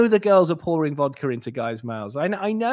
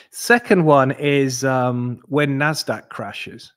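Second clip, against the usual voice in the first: slow emphasis; 5 dB louder, much slower than usual. Real, not acted. Announcing second sell signal.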